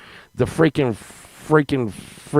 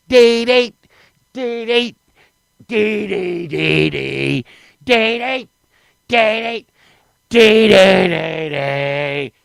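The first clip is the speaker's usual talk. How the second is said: strong man noise; 3 semitones higher, much slower than usual. Retold or imitated. Imitating strong man holding rope tug.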